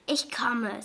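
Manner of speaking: ordinary delivery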